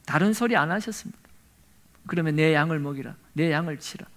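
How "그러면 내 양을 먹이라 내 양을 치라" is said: quiet gentle command. Acted. Jesus commissions Peter.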